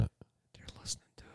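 hushed conspiratorial whisper. Pretend. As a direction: whisper it like a secret.

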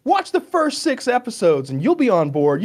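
high-pitched imitation of a pleading fan; mock fan persuasion. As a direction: mimic someone coaxing you into a show. Voicing fans who plead patience.

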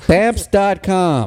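high, drawn-out slogan read; mock sponsor plug. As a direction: stretch it out like an advertising tagline. Mock sponsor plug after recommending.

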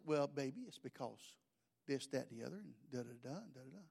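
soft, low, trailing off to near nothing; soothing dad. Retold or imitated. Father gently answering his child, soft and low. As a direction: hush it like a tired father.

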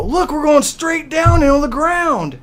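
very high, loud, drawn-out fake scream; mock terror. Dad to crying daughter at the drop, mock terror. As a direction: wail it slowly in pretend horror.

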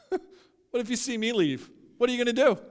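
high, forceful dare; bold challenge. Acted. Jesus pre-empting the disciples' exit, bold challenge.

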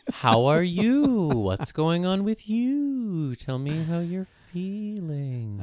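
high, slow, gentle mock counselor; therapist voice. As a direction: coo it like a syrupy therapist. Mock therapist check-in with spouse.

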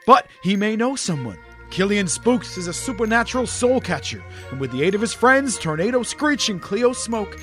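smooth promo voiceover read. Acted. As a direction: read it like a book advert. Ad read over music.

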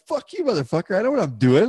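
high-pitched mock snarl at a rival; angry retort. Rider snaps at the guy pushing him aside, retold angrily. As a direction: fire it back like you're insulted.

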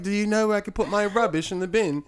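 put-on high British kid's voice; imitated British accent. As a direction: quickly mimic a British kid. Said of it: "That was actually really good".

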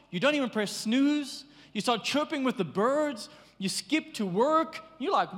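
ordinary talk

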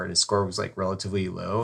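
normal talking voice

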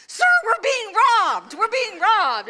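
high, loud and slow, pleading; panicked warning. Retold or imitated. Woman warning a passerby on the sidewalk, high panicked call. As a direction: grab the stranger's attention, high and loud.

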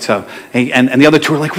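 normal talking voice

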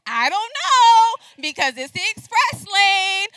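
loud, slow, drawn-out sass; giving attitude. Retold or imitated. Shopper sassing the cashier about the express lane, slow and loud. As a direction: lean on every word with attitude. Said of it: "I'm giving attitude".

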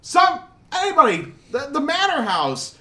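high breathless panicked shouting; frantic shout. Acted. Messenger bursting into the bar, frantic high shout. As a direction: burst in yelling the news. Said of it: "Somebody runs in".

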